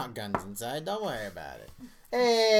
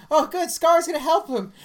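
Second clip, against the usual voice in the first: put-on high excited child voice, louder; imitating a little kid; 14 semitones higher, 11 dB louder than usual. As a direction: play the hopeful little boy watching the movie. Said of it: "And he's like".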